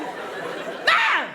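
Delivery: very high, loud short yell for Mum; panic. Shown as a demonstration. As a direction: scream for Mum as a panicking child. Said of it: "a whole new level of panic".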